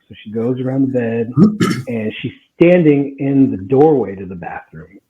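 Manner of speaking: usual speaking voice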